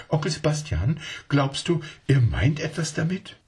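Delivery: put-on high, eager little child asking its uncle; a small child not believing a word; child's voice.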